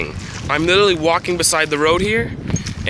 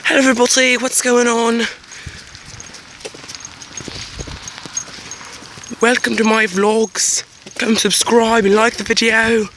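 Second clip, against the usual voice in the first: mock British youtuber; 9 semitones higher than usual. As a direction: ham up a cheery British youtuber greeting. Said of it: "simple people that go".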